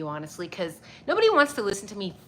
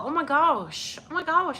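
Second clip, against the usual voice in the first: delighted to see him; excited; 6 semitones higher than usual. Real, not acted. Excited at Idaho viewer.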